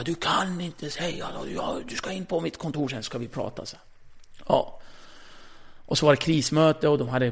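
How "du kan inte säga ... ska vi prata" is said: imitating a furious boss, higher but held back; clipped scolding summons to the office; angry boss. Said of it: "han är så arg och bara". (chefen som skäller, lätt imiterad)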